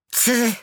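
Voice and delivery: very high, loud scoffing "tse"; scornful tsk. A narrator's reading. Blue-spotted raven scoffing at the snowman, high and loud. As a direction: scoff in disdain. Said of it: "machte der Blaugetupfte".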